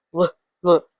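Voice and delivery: "Look, look" said as a short luh, luh; L sound. Shown as a demonstration. Teacher showing how L sounds, L sound. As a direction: sound out L in "Look, look" as two separate syllables. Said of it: "how the sounds of the L sounds".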